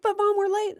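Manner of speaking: very high, loud mock-kid plea; protesting kid. Kid telling mom they are late, mock frantic protest.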